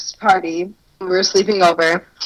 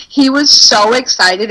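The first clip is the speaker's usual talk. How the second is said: ordinary talk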